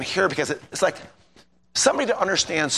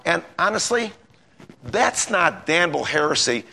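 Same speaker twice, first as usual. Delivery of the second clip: usual speaking voice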